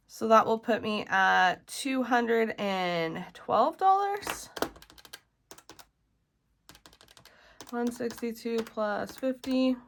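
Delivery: groggy just-woke-up morning voice; rough morning voice, barely used yet. Real, not acted. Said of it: "You can probably tell I still have my morning voice".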